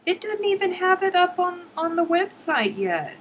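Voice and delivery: baffled and amazed; high-pitched astonishment; astonished. Real, not acted. Shocked magazine not online yet.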